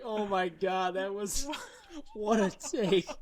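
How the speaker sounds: delighted amazement breaking into a laugh. Real, not acted. Dad laughs at his daughter's line.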